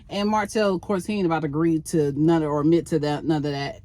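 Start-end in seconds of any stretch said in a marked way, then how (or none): none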